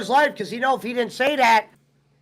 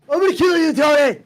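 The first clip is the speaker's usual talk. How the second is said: higher, louder mock death threat; 5 semitones higher, 5 dB louder than usual. Punk threatening Tony Khan, mock angry yell. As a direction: snarl it like a wrestler threatening his boss.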